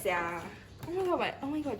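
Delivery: ordinary talk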